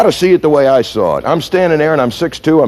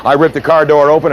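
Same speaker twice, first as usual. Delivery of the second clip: normal talking voice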